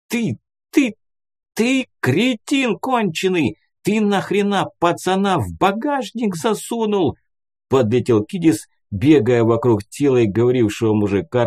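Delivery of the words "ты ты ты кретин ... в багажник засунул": high-pitched, agitated squawk of accusation; shrill-pitched, flustered scolding; frantic outrage. A narrator's reading. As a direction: sputter indignantly, voice pushed way up high. Kidis frantically berates the man.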